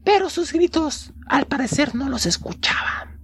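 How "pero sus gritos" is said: usual speaking voice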